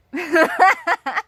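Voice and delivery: loud high burst of laughter; real high-pitched cackle; laughing. Real, not acted. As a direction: laugh out loud at a silly bit. Laughing before the accent bit.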